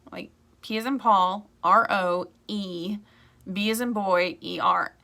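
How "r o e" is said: normal talking voice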